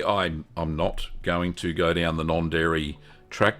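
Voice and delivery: usual speaking voice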